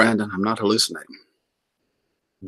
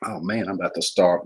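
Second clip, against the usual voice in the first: normal talking voice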